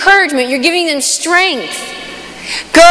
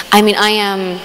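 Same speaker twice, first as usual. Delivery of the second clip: usual speaking voice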